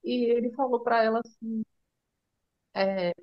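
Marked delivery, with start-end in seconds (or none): none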